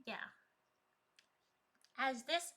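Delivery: usual speaking voice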